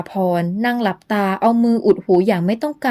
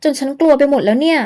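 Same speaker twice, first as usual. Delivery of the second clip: put-on higher, frightened girl's voice; startled young woman; 6 semitones higher than usual. Young woman warns Luang of the ghost, higher and frightened. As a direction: gasp it out to Luang, scared and high.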